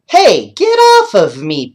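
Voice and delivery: high, loud laughing protest; loud playful boy's whoop. Acted. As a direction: yelp it while being licked. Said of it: "Paul whooped".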